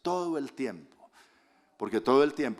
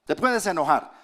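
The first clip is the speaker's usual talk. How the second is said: 1 preaching loud; 7 semitones higher, 7 dB louder than usual. Real, not acted. Preacher proclaiming at full force.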